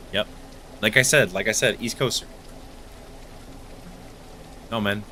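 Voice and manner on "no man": ordinary talk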